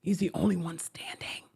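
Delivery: whisper. Real, not acted. Whispered repeat.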